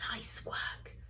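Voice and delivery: soft whisper, slowing on "Just in time"; grandpa's relieved hush; whispered praise. Acted.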